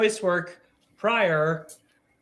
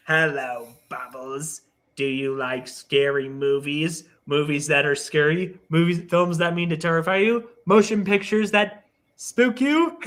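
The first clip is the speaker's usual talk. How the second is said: Ghostface. Acted. Horror villain taunts Bubbles in Mojo Jojo's wordy style, Ghostface impression. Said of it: "would in the Ghostface voice".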